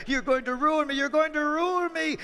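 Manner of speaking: high, loud desperate cry; panicked farmer. Acted. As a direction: plead in panic as all is lost.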